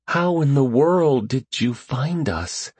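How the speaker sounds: high, slow, uneasy question; hint of fear. A narrator's reading. Vivian asks Pup how he found them, slightly fearful. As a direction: draw it out with a touch of dread. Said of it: "a hint of the old fear in her voice".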